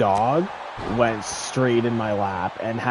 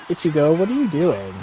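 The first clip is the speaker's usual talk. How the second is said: a higher, louder call to the dog; calling owner; 7 semitones higher, 4 dB louder, faster than usual. Retold or imitated. The owner calling her dog back, louder and higher. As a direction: call out to the dog in mock surprise.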